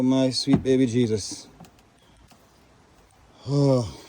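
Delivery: loud, anguished calling out at the wreckage; real loud dismay; distressed loud lament. Real, not acted. Storm damage, distraught loud lament.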